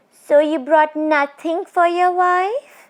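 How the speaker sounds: high, eerie teasing spirit voice; a tall witch toying with her prey; ghost woman. Acted. Ghost woman teasing the frightened Bishan Singh, eerie and high.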